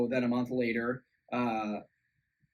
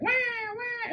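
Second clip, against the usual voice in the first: high mock baby wail; imitated baby crying; 4 dB louder than usual. Retelling the baby crying at dinner, high mock wail. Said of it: "it starts crying".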